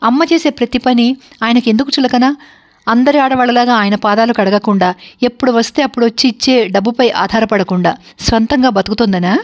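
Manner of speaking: plain reading voice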